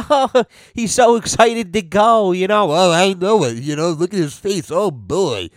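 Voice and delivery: mock doting parent. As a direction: gush over the screaming kid in mockery. Mocking clueless parents at airport.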